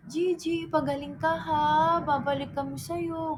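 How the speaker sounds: put-on high, slow, babying voice to the dog; retelling a goodbye to the sick dog in a high baby voice; tender goodbye to a sick dog. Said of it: "Tapos sabi ko".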